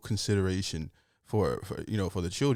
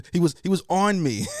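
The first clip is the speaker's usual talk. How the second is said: loud; 10 semitones higher, 8 dB louder than usual. Retold or imitated. Listener echoes the complaint loudly.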